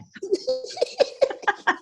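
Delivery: short high laugh at herself. Real, not acted. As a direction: laugh at your own silly claim. Laughs at her own claim.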